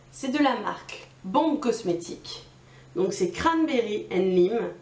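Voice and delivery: ordinary delivery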